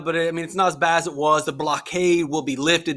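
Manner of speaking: normal talking voice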